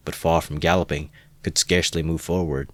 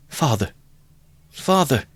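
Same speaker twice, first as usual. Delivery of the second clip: rising, pleading call; child crying out; 8 semitones higher than usual. Acted. Frightened boy calling his father, pleading cry. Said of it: "he cried".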